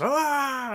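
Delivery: mock scream. Henchmen yell before explosion.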